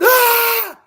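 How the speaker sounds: sudden high startled scream of fright; frightened yell. Retold or imitated. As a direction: shriek at the sight of a snake. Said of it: "I just yelled".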